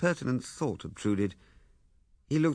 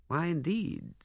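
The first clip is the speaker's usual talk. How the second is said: plain reading voice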